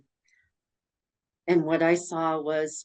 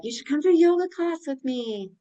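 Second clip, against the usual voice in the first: friend's invitation; 9 semitones higher, 3 dB louder than usual. Retold or imitated. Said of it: "a friend said".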